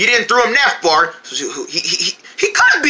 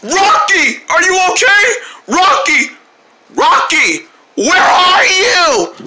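frantic calling; 8 semitones higher, 3 dB louder, slower than usual. Acted. Calling for the lost rock.

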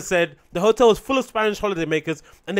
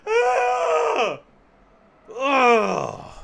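very high mock scream, sinking to a wail; drawn-out fake cry of horror; 8 semitones higher, 3 dB louder than usual. Comic mocking pensioner's slope complaint, fake wailing scream.

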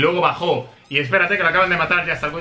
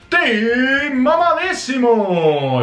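drawn-out celebration; 6 semitones higher, much slower than usual. Real, not acted. Closing celebration.

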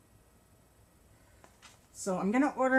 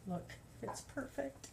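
soft gushing whisper; 12 dB quieter than usual. Real, not acted. Showing off finished crochet piece.

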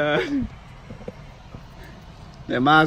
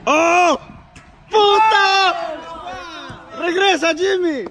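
excited shout; 11 semitones higher than usual. Real, not acted. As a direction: shout the call across the room. Yelling at a teammate in the game.

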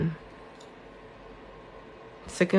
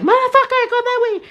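high mock yell at the TV, much higher; imitating a TV yeller; 5 dB louder, faster than usual. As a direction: ham up a fan yelling at the screen. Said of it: "they're yelling at the T V".

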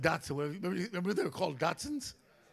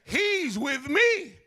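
calling out; 8 semitones higher, 8 dB louder, much slower than usual. Retold or imitated. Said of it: "Nicky gets out of the car and says".

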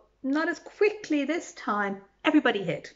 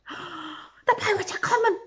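high, breathless alarm; gasping alarm; 8 semitones higher than usual. Acted. Villagers gasping in alarm at the pirates. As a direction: gasp it out in fright. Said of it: "gasped the villagers".

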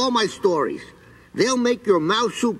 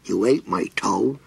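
much lower, flat disbelieving question; low creature question; 10 semitones lower than usual. Acted. Creature asks the mouse in low disbelief. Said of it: "he said".

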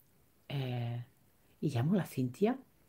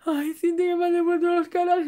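high, fast mock sob on the phone; playfully imitating her own tearful phone call to Cintia; imitating crying; 12 semitones higher, 4 dB louder than usual. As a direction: whine it out much higher like a tearful call. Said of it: "Y la llamo yo llorando".

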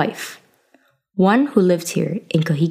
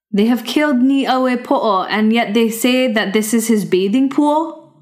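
higher, incredulous retort; young hero scoffing; 5 semitones higher than usual. Acted. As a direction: throw their words back at them. Youth scorns the guards' answer.

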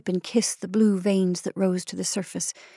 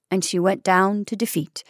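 ordinary delivery

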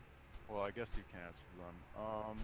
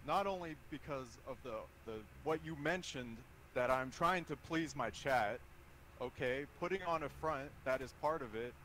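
nervous breakdown; 4 semitones higher, 9 dB louder than usual. Real, not acted. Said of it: "This is me talking right now".

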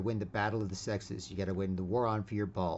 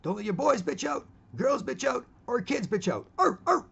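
[name] impression; 14 semitones higher, 6 dB louder than usual. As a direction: bark it like [name]. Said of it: "D M X ... off saying".

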